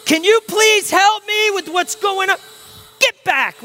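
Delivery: high, loud, frazzled plea; flustered and snapping; frantic Martha. Retold or imitated. Martha freaking out while vacuuming, high frazzled yell. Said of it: "she's, like, going".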